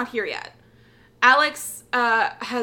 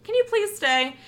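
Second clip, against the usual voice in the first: pitched-up desperate please stay; pleading plea; 9 semitones higher than usual. Retold or imitated. Alex begging the host to stay, high and pleading. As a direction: beg her not to leave, voice jumping up. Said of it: "and being like".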